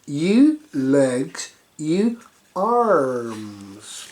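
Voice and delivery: ordinary delivery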